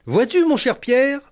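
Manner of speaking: high, slow mentor voice; a lofty older colleague giving advice; Jacques Martin. Retold or imitated. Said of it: "Jacques Martin ... me fit une remarque".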